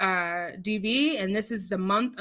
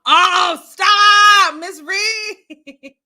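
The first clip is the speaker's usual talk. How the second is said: loud, high squealed shout; playful protest; 8 semitones higher, 10 dB louder, much slower than usual. Real, not acted. Host answering Miss Reed's comment, playful protest. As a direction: shout it high, breaking toward laughter.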